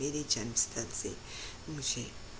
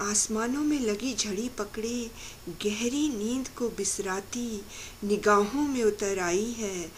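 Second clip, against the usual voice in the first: louder, lifted poetry declamation; ringing, raised recitation; emphatic verse repeat; 4 semitones higher, 6 dB louder than usual. Acted. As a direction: declaim the verse with rising force. Repeats the verse with more force.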